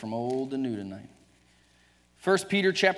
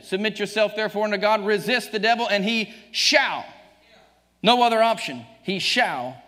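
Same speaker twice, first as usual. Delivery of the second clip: loud, higher fiery preaching; full force preaching; 6 semitones higher, 6 dB louder than usual. Acted. Preacher exhorting the congregation at full force. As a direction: thunder the verses at the church.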